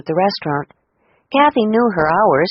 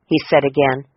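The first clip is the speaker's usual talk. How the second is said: ordinary delivery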